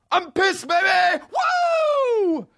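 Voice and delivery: high mock-party shout; sarcastic whoop. Comedian fake-celebrating his anger, high whooping shout. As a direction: cheer like a frat boy while furious. Sarcastic cheer when angry.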